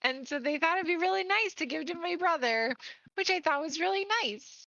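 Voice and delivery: earnest fan. Acted.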